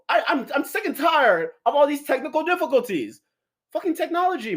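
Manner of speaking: real exasperation boiling over; frustrated rant. Real, not acted. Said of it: "why are you yelling at me".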